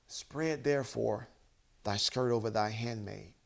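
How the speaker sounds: low, soothing tone with long pauses; putting him at ease. Shown as a demonstration. Ruth answering startled Boaz, putting him at ease. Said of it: "it sounds like she is trying to put him at ease".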